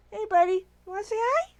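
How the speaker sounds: high sing-song voice for a dog. Real, not acted. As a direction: coo at the puppy. Talking to the puppy.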